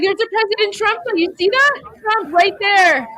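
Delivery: high, loud, eager pointing-out; excited spotting. Real, not acted. Streamer spotting the Trump flag in the crowd, excited. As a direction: call out a sudden find.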